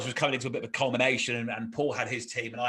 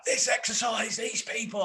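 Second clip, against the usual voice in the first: much higher, exasperated rant; 9 semitones higher than usual. Retold or imitated. Paul venting to Tim behind a tree, higher rant. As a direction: start ranting in exasperation. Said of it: "he was like".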